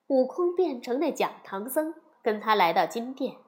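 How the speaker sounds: ordinary delivery, nothing marked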